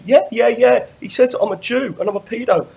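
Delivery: high, loud frantic mimicry; overexcited insistence, hammed up; semi-hysterical rant. Caller complaining to Brian, mimicked hysterically. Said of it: "a bit semi-hysterical homo rant".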